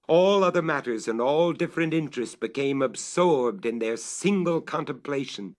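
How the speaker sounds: usual speaking voice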